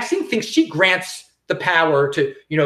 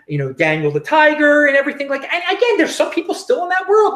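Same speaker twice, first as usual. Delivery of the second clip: ordinary talk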